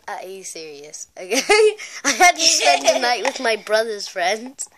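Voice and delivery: usual speaking voice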